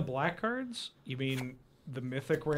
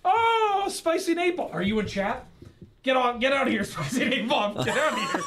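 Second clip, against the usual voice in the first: high, loud, laughing banter; playful teasing; 9 semitones higher, 4 dB louder than usual. Real, not acted. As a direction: shoo a friend off jokingly. Teasing chat viewer.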